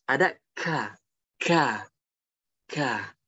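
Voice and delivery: ka with breath escaping; breathy kaf. Shown as a demonstration. Teacher demonstrating breathy kaf. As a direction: let air out on ka. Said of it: "ada nafas yang keluar".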